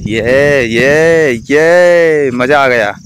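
loud, drawn-out cheer; excited cheering. Real, not acted. Cheering a friend leaping over the hole, excited. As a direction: whoop for the stunt.

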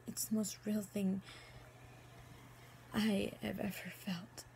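soft, low and tender, with long pauses; intimate, halting admission; hushed love confession. Acted. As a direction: confess it quietly, almost to yourself. Acted love confession monologue.

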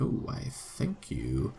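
low, soft, slow put-on thank-you; mock grateful sim. Voicing a sim thanking her for the framing, mock prim. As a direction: ham up a prim little thanks. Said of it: "I'm like".

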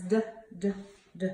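"D" as a short duh, not the letter name; letter sound demo. Shown as a demonstration. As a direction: sound out "D" in separate quick bursts. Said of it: "Dee Dee Deer says".

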